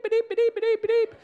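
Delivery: high, loud imitated bleeps; beeping like a censor tone; mock censor bleep. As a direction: bleep out his swearing.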